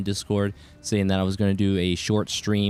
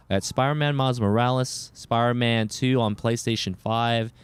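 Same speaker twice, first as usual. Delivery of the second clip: normal talking voice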